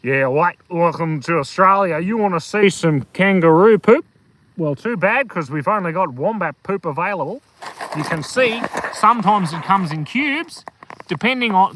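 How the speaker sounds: broad bogan tour-guide welcome; Australian bogan accent. Shown as a demonstration. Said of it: "the Australian bogan accent's pretty good".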